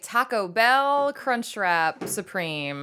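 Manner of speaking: slow loud warmup. Shown as a demonstration. Vocal warmup.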